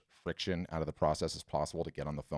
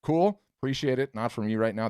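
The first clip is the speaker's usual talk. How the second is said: higher, louder, breezy no-thanks; acted customer declining a demo; polite brush-off; 6 semitones higher, 6 dB louder than usual. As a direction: politely wave off a sales call, pitched up. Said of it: "you talk to the outreach team and you say".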